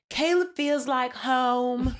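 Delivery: lightly mock her swooning; mock dreamy swooning. Friend imitating a contestant mooning over Caleb, slow. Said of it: "So she's like".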